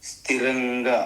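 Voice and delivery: usual speaking voice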